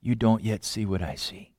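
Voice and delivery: put-on soft, low, tender reply to a child; God answering the child, soft and patient; gracious and patient. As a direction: gently soothe a frustrated child. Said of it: "he says, graciously and patiently".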